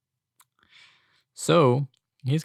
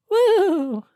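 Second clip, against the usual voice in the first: high, loud whoop; loud falsetto mock celebration; mock cheer; 10 dB louder than usual. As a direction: let out a big high-pitched woo. Sarcastic cheer about the system.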